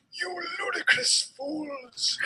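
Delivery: squeaky hammed-up old wizard; lightly voiced angry headmaster; Dumbledore shouting. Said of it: "he shouted".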